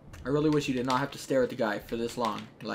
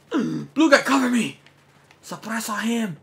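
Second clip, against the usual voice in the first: high, loud shout to a teammate; urgent call for cover; 8 semitones higher, 6 dB louder, slower than usual. Real, not acted. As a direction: yell for backup under fire. Calls to a teammate under fire.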